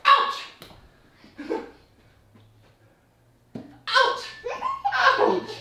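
high yelped ouch; playful pained yelp; mock ouch. Child hitting him.

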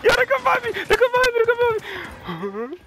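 high, loud, laughing panic; panicked cry. Real, not acted. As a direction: shriek for help, half laughing. Panicked laughing call for help.

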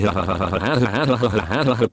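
breathy mock villain cackle; hammed-up wicked laugh; evil cackle. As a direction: cackle like a gleeful cartoon villain. Cackling over his own villain boast.

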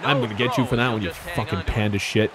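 very low, very soft grumble; low, barely audible menace; muttered threat. Real, not acted. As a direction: mutter the threat under your breath. Threatens game opponent under his breath.

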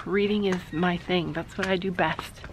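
hushed and soft; quiet bookstore voice. Real, not acted. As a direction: keep it down in the shop. Whispering in a bookstore.

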